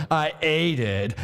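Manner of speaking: plain reading voice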